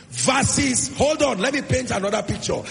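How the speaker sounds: loud, emphatic sermon delivery; full-force preaching. Acted. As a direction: preach it loudly, building to a peak on "finally".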